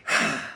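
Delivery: imitated wifely huff of breath; exasperated sigh. Husband mimicking his wife in the car, exasperated sigh. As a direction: blow out a mock sigh. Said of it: "I hear the blow of the breath".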